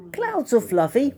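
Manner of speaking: high, hopeful little character voice; bright idea. A narrator's reading. Fluffy hits on a plan to get fluffy, eager and high. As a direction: pitch it up like a sudden idea. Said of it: "he thought".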